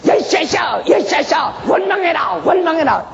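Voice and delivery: high shouted urging; devil's shout. Acted. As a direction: yell it like the devil egging him on.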